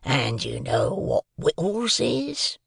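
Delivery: slow, drawn-out rough convict role; menacing convict. A narrator's reading. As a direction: draw the words out to threaten a child. Convict threatening the boy.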